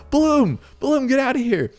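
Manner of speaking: high, loud shout at the screen; shouting at the game. Real, not acted. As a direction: yell at the tower to hurry. Yelling at the game.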